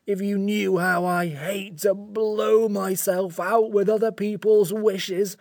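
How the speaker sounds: slow, plaintive creature lament; sorry-for-itself creature, close to tears; tearful. A narrator's reading. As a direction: pour out the misery, stretching each word. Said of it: "it said in a tearful voice".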